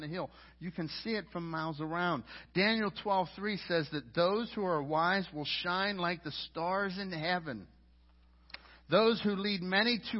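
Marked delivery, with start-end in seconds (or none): none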